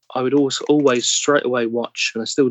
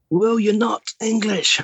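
high-pitched imitation of being shouted at; mock shouted rebuke; 10 semitones higher, slower than usual. Actor imitates someone shouting about the accent on set, mock shouted rebuke. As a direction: call it out slowly and high, mimicking the telling-off. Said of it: "I've been shouted at a few times".

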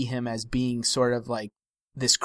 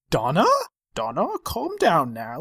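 put-on Welsh accent; 3 semitones higher than usual. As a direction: settle her down, Welsh lilt. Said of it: "is that your Welsh accent".

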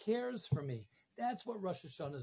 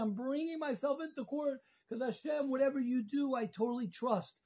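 pleading trust; 6 semitones higher than usual. Pretend. Voiced prayer, raised pitch.

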